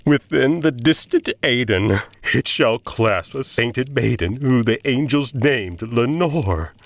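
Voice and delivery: high, aching plea; desperate pleading. Acted. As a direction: beg to know if she waits in heaven. Desperate questioning of raven.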